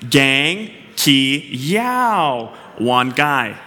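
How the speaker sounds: Gang ki yao wan gai drawn out syllable by syllable, very slow; slow spelled-out Thai. Shown as a demonstration. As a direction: stretch every syllable of Gang ki yao wan gai so the room can follow. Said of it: "say it out with me".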